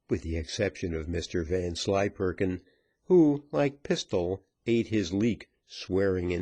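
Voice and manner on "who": usual speaking voice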